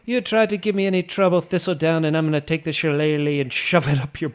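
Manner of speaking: stage Irish leprechaun voice; Irish accent. Pretend. Irish trip, mock Irish threat.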